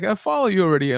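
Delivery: excited fan gush. Retold or imitated. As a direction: tell him you're already a fan, thrilled. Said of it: "I'm like".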